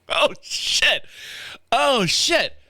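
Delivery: sudden sheepish realisation; embarrassed realisation. Retold or imitated. Realising it is Saturday.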